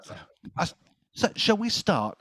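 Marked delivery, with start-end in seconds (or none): 1.1-2.2 quiz host opener. Acted. Quiz host opening the show.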